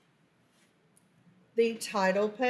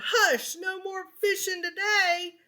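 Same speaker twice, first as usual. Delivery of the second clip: Gramps yells; 9 semitones higher than usual. Acted. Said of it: "Gramps yelled".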